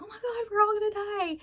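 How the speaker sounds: high, panicky mock freak-out; hammed-up terrified wail. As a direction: squeal it like a panicking teen in a horror film. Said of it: "one of them will freak out".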